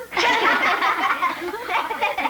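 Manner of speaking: a short burst of real laughter. Real, not acted. Kids laugh after asking about calling the government, amused laughter. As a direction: laugh at the teacher's answer.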